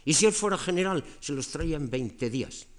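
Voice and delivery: alto y fuerte, Cleón alardeando; fanfarronada a gritos. Retold or imitated. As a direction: imitando a un político que presume a voces. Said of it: "una manera muy fuerte de hablar". (arenga jactanciosa, mucho más fuerte y aguda)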